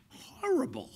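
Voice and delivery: breathless whispered horrible; whispered horror. Retold or imitated. Actor whispering his reaction to a script.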